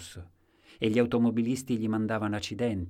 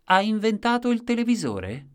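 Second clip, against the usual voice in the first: loud, high incredulous query; pitched-up outburst from a bystander; exclaimed question; 13 semitones higher, 8 dB louder than usual. A narrator's reading. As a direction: call it out as a mocking question to all. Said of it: "esclamò qualcuno".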